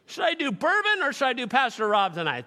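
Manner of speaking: lightly playing a sleepy listener; mock listener. Preacher voices congregant picking bourbon or sermon, mock. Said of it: "some of you put on the app".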